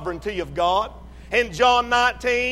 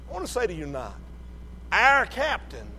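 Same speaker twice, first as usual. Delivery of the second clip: ordinary talk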